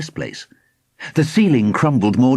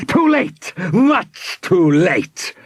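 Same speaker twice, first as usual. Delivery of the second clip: high, gloating villain cry; mocking villain yell; 9 semitones higher, much slower than usual. A narrator's reading. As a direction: jeer at your beaten foes. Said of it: "yelled Aspio with a mocking laugh".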